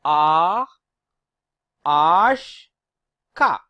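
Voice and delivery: R, Ash, Ka with loud open ah; French letters A, H, K on a drawn-out ah; open "ah" sound. Shown as a demonstration. Teacher groups alphabets with the same sound, loud open ah. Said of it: "have the R sound".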